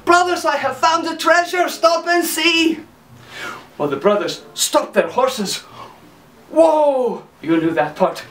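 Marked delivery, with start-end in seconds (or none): 0.1-2.8 high excited call; Hans calling. Acted. Silly Hans calls high to his brothers, excited. 6.5-7.2 whoa call. Acted. Horse stop call.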